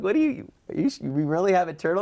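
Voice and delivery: can't believe there's a turtle here; disbelieving surprise. Retold or imitated.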